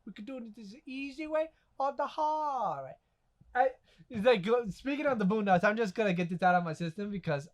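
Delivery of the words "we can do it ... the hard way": soft, lightly voiced impression; hushed imitation with stretched key words; Booty Warrior. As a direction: quote him quietly, drawing out the offer. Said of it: "this universe's booty warrior".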